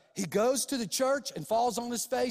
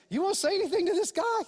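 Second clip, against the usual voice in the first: higher, fast prodding question; quick eager question; 9 semitones higher, faster than usual. Retold or imitated. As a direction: toss the question over, quick and pitched up. Pastor turns to ask me.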